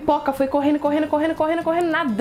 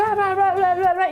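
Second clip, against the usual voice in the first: mock arguing; 6 semitones higher than usual. Fish quarreling loudly.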